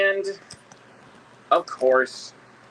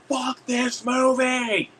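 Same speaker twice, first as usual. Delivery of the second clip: high, drawn-out holler; mock yell; 13 semitones higher, 4 dB louder, much slower than usual. Moviegoer standing up at a terrible sequel, mock yell. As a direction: stretch it out high like a theater heckler. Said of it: "I will yell that out".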